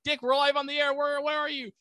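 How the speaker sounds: high-pitched, urgent mock phone voice; mock urgent caller. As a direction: act out a studio urgently calling its reporter. Frantic studio on the phone.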